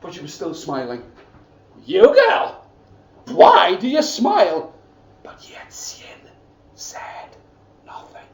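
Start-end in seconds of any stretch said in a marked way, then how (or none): 1.9-4.7 much higher and louder, sharp cry; shrill demand. Acted. Stepmother shouts at smiling girl. 5.2-8.3 whispered, hushed. Shown as a demonstration. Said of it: "Can you do that with me?".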